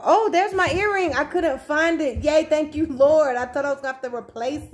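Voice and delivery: real delighted outburst; excited relief. Real, not acted. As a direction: squeal with relief at finding it. Finding her lost earring.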